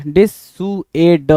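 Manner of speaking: डिसुएड said very slowly, every sound held; drawn-out word. Shown as a demonstration.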